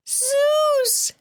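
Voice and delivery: 高く鋭い叫び声で「Zeus!」; 声の限りに叫ぶ; shriek. A narrator's reading. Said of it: "she shrieked in the loudest voice".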